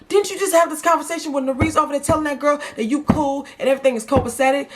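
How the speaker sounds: high-pitched, indignant scolding; exasperated, irritated rant. Real, not acted. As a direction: fire off incredulous questions at her. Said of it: "I was so irritated".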